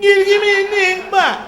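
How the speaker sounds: high, very loud blustering order; hammed-up oblivious bellow; mock loud customer. Comic imitating noisy audience member over his show, mock bellow.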